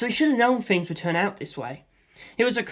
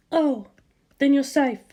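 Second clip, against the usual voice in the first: mother voice; 8 semitones higher than usual. A narrator's reading.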